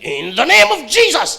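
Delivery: high, loud, slow rebuke; Pentecostal rebuke. Shown as a demonstration. Pentecostal rebuking in Jesus' name, loud and slow. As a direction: command it like a preacher. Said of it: "Ganito tayo mag-rebuke".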